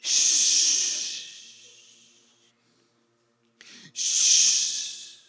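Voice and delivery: long hissed shh; loud shush. Acted. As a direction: shush the complainers. Hushes congregation.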